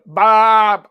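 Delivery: high, loud goat bleat shaped like Bob. Retold or imitated.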